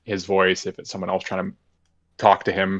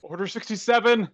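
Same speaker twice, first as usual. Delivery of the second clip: excited shout; 12 semitones higher than usual. Pretend. Joking Star Wars order callout.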